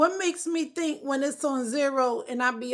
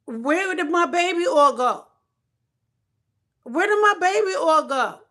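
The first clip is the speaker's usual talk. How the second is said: louder, higher frantic calling out; frantic search; 4 semitones higher, 6 dB louder than usual. Real, not acted. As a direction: call out in a panic for the lost oil. Frantic search for baby oil.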